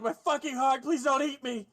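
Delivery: high, frightened pleading; terrified bar hand. Acted. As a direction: beg for your life, heart pounding. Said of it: "He's like".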